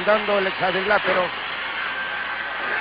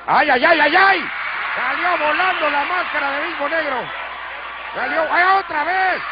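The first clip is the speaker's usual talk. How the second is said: excited shout; 7 semitones higher than usual. Real, not acted. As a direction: yell out in shock at the mask. Mask flies off mid-match.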